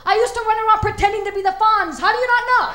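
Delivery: loud, high exasperated yell; exasperated shout. Retold or imitated. Comedian shouting back at her mother, exasperated. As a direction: shout it in disbelief, voice cracking high. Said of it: "I'm like".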